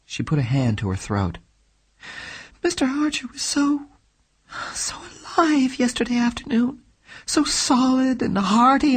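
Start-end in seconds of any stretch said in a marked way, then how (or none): 2.6-8.9 put-on a lightly higher, grieving young woman; lightly voiced, mournful young woman; young woman's voice. A narrator's reading. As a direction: voice the distressed client, a touch higher. Heroine grieving over partner.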